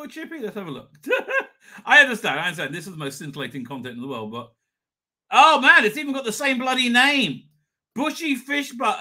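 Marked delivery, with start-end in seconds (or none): 5.3-9.0 loud, higher amazed exclaim; delighted surprise. Real, not acted. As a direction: marvel that nothing has changed. Delighted the chippy kept its name.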